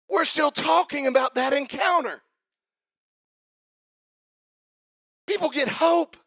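high, driving revival-preacher shout; full-force preaching. Real, not acted. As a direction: build to a ringing, impassioned climax.

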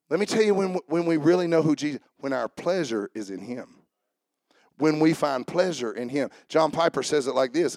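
usual speaking voice

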